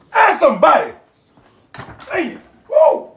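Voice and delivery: high, loud ask somebody then a whoop; excited catchphrase shout. Real, not acted. Cook signing off his show with an excited whoop. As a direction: belt out the sign-off and whoop with glee.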